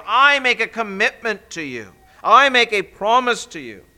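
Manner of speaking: high, loud, slow proclaimed promise; preaching at full force. Acted. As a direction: proclaim each word of the promise, building. Repeats Jesus' promise, preached.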